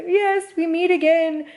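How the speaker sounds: high hammed-up villain line; mock villain triumph. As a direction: gloat like a cartoon villain. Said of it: "And I'm all like".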